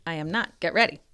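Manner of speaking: usual speaking voice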